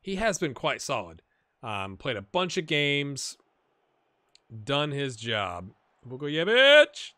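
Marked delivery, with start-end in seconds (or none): 6.1-7.1 loud, rising, stretched-out cry; excited name call. Real, not acted. Commentator calling the player's name, loud rising shout. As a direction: holler the name, climbing in pitch.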